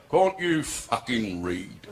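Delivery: low, slow drawn-out sergeant-major demand; RSM policeman. Acted. Policeman asks bather about the sign, sergeant-major voice. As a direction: stretch it out like an exasperated drill sergeant. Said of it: "Used to be an R S M".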